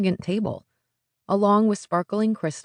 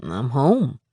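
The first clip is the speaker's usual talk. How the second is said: casual Texas drawl; 3 semitones lower, 3 dB louder than usual. A narrator's reading. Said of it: "with a casual lift of his broad shoulders".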